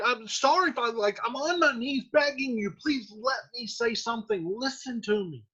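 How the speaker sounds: pleading daughter. Retold or imitated. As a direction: beg quietly to be heard. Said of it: "she's like".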